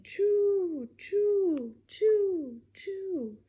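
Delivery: high, loud chugging choos; puffing train whistle sounds. Pretend. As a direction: chuff like a steam train. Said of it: "like a train".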